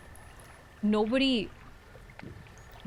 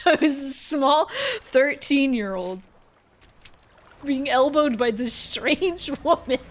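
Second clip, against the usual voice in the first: higher, louder, laughing through the words; laughing while talking; 6 semitones higher, 6 dB louder than usual. Real, not acted. Telling of the elbowing stranger, laughing while talking. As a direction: struggle to tell it through laughter. Cracks up telling own story.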